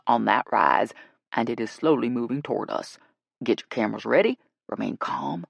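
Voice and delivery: low, firm guide voice; authoritative guide. Acted. Guide briefing the group on the Sasquatch, low and firm. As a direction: assert your expertise in a deep voice.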